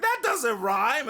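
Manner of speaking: high, slow mock protest; stretched-out incredulous complaint; baffled objection. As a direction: object in drawn-out, high-pitched disbelief. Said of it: "you would be like".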